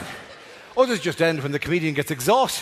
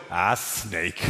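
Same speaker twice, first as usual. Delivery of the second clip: low, soft, weary shrug of a line; bored sigh; 12 semitones lower, 5 dB quieter than usual. Retold or imitated. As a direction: say it as if utterly unbothered. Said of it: "he'd just go".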